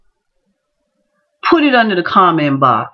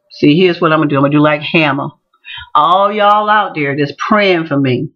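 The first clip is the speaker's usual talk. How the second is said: normal talking voice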